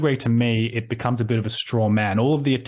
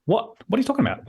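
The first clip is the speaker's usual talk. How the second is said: high and fast, disbelieving; baffled outrage; 12 semitones higher, faster than usual. Retold or imitated. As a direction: fire back in total confusion. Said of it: "She's like".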